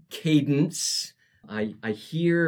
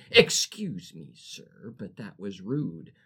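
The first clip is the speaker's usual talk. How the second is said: icy voice; 6 semitones lower than usual. Acted. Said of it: "Her sweet voice turned to ice".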